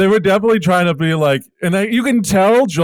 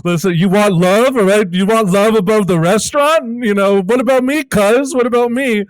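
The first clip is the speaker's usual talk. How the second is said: mock pleading cousin; 6 semitones higher than usual. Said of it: "they're like".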